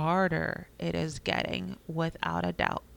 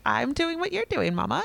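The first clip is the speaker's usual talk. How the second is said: higher, childlike imitation of a little one; lightly voiced toddler; 8 semitones higher, 4 dB louder than usual. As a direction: mimic a toddler talking back to mom. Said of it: "I'm like".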